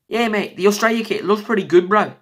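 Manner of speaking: half-hearted mock Aussie bloke; playful, clumsy Australian accent; bad Australian accent. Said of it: "I'm very bad with accents".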